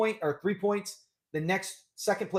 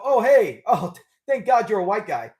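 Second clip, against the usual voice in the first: mock relieved greeting; 2 semitones higher, 7 dB louder than usual. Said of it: "I would not go".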